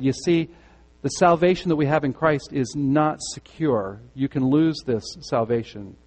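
ordinary delivery

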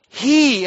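"He" punched high and loud; stress on he. Shown as a demonstration. Preacher voicing John, hitting "He" hard. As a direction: ring out "He" on its own. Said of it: "the emphasis is upon he".

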